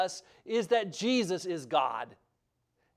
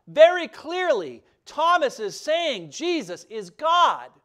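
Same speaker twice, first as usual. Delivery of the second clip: preaching emphasis; 8 semitones higher, 7 dB louder than usual. Real, not acted. Preaching climax on deity.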